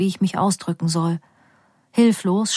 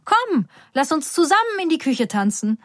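mother; 8 semitones higher than usual. A narrator's reading. Mother dancing with phone.

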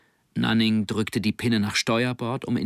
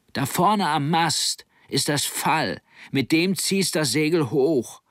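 higher-pitched called instructions; calling out; 7 semitones higher, slower than usual. A narrator's reading. Helmsman showing his mate how to hoist sail, calling out. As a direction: call directions up to the bow. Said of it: "rief er".

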